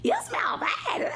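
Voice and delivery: high, drawn-out mock wail; imitating disgusted boyfriend. Boyfriend complaining about the smell, high mock wail. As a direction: squeal it slowly in mock horror. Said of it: "He was like".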